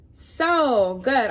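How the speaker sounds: usual speaking voice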